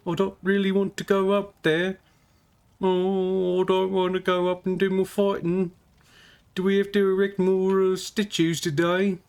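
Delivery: high, whiny, weary goblin voice; a high, worn-out goblin moaning about statues; tired goblin. Acted. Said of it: "he kinda looks like he just".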